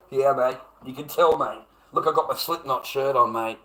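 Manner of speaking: mock the trolls with a shirt as proof; mock sarcasm. Mocking his own "violent" look.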